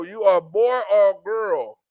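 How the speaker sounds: slow and firm, each word set down; stern slow correction. Acted. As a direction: lay it down slowly like the final rule.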